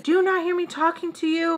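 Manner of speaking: sharp, high annoyed demand; angry. Retold or imitated. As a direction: snap it at her. Boyfriend's angry retort.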